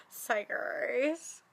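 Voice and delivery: usual speaking voice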